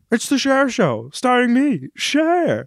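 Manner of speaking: high, grand announcer-style [name] impression. Mimicking the [name] Show ad to his cohost, high and grand. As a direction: belt it out like the Broadway ad.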